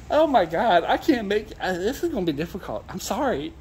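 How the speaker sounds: high voice squeezed by real laughter; helpless, apologetic, barely holding back laughter; laughing to tears. Real, not acted. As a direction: struggle to talk after a laughing fit. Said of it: "I'm laughing my ass off".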